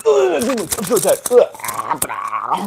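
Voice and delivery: die noisily and theatrically; mock dying. Acts dying on the ground.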